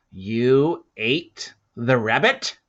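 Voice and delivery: slow, loud, doubting question; suspicious question. Acted. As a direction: drag it out in disbelief. Volt asks the boy.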